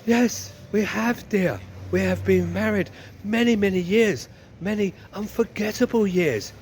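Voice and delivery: comic voice of a long-suffering wife. Acted. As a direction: play the long-married wife, drawing out the words. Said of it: "And the wife says".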